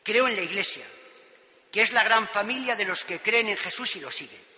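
solemn declaration of faith; fervent sermon creed; preaching. Acted. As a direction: proclaim it from the pulpit.

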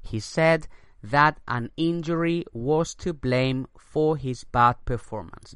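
slow English repeat; slow dictation read. Shown as a demonstration. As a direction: say it again slowly. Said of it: "Repito la frase".

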